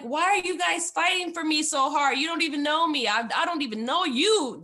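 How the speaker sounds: high, loud, bewildered outburst; exasperated plea. Retold or imitated. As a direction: throw the question at them, overwhelmed. Pleading with staff fighting for her.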